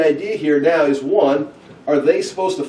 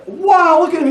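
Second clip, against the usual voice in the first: high, loud mock wow; awed crowd; 7 dB louder than usual. People watching Jesus make bread, hammed-up amazement. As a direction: marvel out loud like an onlooker. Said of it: "it's just like".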